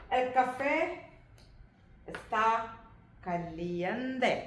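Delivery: El café está caliente, each word slow and separate; careful teaching read. Shown as a demonstration. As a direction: spell it out word by word for learners. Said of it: "മാറ്റം കണ്ടോ". (പതുക്കെ വാക്ക് വാക്കായി പഠിപ്പിക്കുന്ന രീതിയിൽ)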